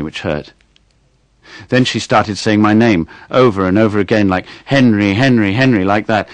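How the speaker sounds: put-on clear, expressive reading of a boy's story. A narrator's reading. Actor reading his short story on air, expressive storytelling. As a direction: read it aloud for a radio audience.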